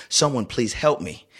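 ordinary delivery

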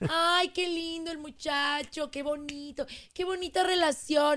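gushing mother. Acted. Mother admires her daughter's new boyfriend, gushing coo. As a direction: coo slowly over the young couple. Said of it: "la mamá primero de".

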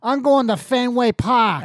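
put-on high, slow, overdone Massachusetts accent; bad Massachusetts accent. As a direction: ham up a fake Massachusetts accent. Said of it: "how to do a fucking accent from Massachusetts".

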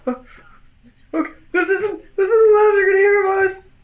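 high, fast, near-crying yell of a fleeing guard; terrified guard. Acted. Locked-out guard shouts back at the vampire, high and panicked. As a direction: shriek the empty threat as you bolt.

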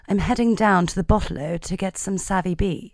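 posh RP accent. Shown as a demonstration. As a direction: sound like a Bridgerton lady ordering wine. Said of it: "Sounds like this".

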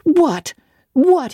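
put-on high, shocked yelp of a spoiled boy; boy crying out in outrage; outraged cry. A narrator's reading. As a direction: shriek it in disbelief. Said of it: "he cried".

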